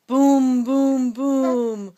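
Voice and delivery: loud booming thunder; thunder booms. Shown as a demonstration. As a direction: boom out like thunder. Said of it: "Mister Brown makes thunder".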